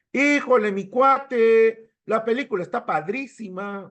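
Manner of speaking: sing-song imitation of a Mexican; Mexican accent. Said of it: "Ah, es mexicano".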